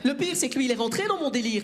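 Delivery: ordinary talk, nothing marked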